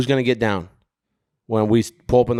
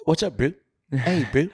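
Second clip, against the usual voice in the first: higher-pitched mock South African greeting; South African accent; 5 semitones higher than usual. Host imitating South African rugby talk, South African accent. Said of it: "South African English".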